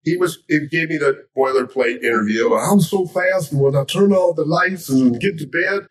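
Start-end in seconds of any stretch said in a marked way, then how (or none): 2.6-5.9 higher-pitched, bragging champion voice; boastful boxer. Acted. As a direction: reel off a showman's boast. Acting Ali's boilerplate boasting interview.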